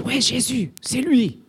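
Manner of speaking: plus aigu, foule qui acclame son héros; s'emballer comme un jeune dans la foule; foule enthousiaste. Retold or imitated. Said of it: "Ils se disent". (acclamation enthousiaste, voix plus aiguë)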